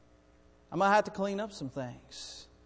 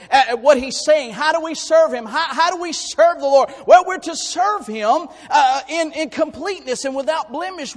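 high, loud impassioned preaching; preaching at full force; 10 semitones higher, 10 dB louder than usual. Real, not acted. Pastor to congregation, loud high fervent preaching. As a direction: lift the voice and preach hard.

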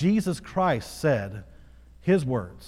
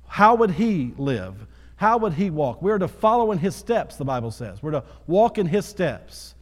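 louder and higher sermon drive; preaching at full force; 4 semitones higher, 3 dB louder than usual. Acted. Pastor urging the church to follow Christ, fervent preaching. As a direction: preach it, lift the room.

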